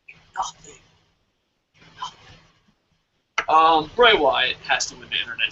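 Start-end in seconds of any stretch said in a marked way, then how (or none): 0.1-2.2 barely audible whisper; whispered, almost to yourself; fading whisper. Real, not acted. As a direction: let the rant die away to a whisper. Rant fades into whispered repeats.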